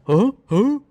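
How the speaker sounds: high, loud puzzled huh from the dog. Retold or imitated. Voicing Batcher the dog, high confused huh. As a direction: play a confused dog looking up. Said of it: "And he's just like".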